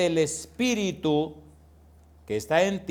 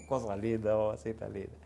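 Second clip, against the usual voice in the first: soft, low, crooning endearment; tender aside; 5 semitones lower, 7 dB quieter than usual. Acted. Preacher croons an endearment to the congregation, soft. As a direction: coo it gently.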